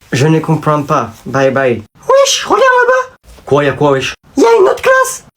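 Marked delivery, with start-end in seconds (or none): 2.1-3.2 much higher, slow put-on voice; squeaky kid voice. Sidekick points out the other class in a squeaky voice. 4.4-5.2 put-on squeaky kid voice. Sidekick points out the other class in a squeaky voice.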